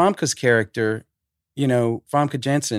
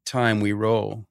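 ordinary talk, nothing marked

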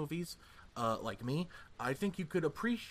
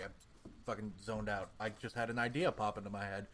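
ordinary delivery

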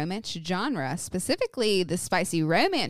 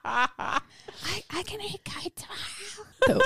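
croaky whisper. Retold or imitated. Imitating sick friend.

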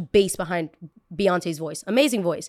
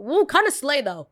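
ordinary talk, nothing marked

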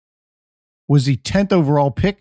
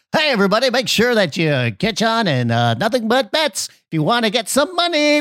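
higher, hyped radio DJ; morning zoo radio voice; 8 semitones higher than usual. Retold or imitated. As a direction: pump it up like a morning zoo host. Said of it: "radio morning zoo show".

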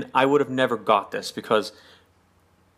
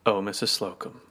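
catchphrase sigh; 3 semitones lower, 6 dB quieter than usual. Retold or imitated. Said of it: "fond catchphrase sigh".

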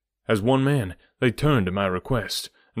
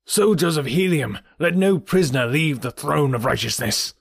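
commanding; 6 semitones higher, 3 dB louder than usual. Acted. Jed commands soldiers in temple.

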